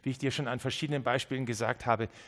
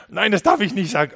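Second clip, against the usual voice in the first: much louder and higher, a sudden shocked cry; alarmed outburst; 7 semitones higher, 12 dB louder than usual. Acted. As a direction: blurt it out as if you caught yourself. Acted listener catches himself. (erschrockener Ausruf, viel lauter und höher)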